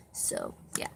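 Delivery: breathy whispered aside. Real, not acted. Streamer muttering to chat in a whisper.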